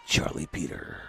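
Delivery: low, dramatic trailer narrator; movie trailer voice. Pretend. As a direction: keep the deep trailer voice going. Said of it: "movie trailer guy".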